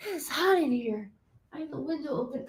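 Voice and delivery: ordinary talk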